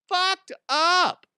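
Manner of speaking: FUCKED UP squealed high and loud; drawn-out high-pitched "Fucked up"; high exaggerated repeat. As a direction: stretch "Fucked up" out in a loud falsetto.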